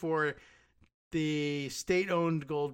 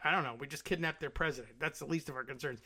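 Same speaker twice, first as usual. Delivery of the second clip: hurried shrug; 4 dB quieter, faster than usual. Retold or imitated. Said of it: "we were like".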